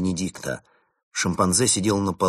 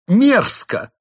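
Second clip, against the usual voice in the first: even higher, loud shriek of disgust; furious despair; 10 dB louder than usual. Acted. Chimp monk at the peak of anger and despair, shrieking. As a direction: scream the word in rage. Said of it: "прорезались злость и отчаяние".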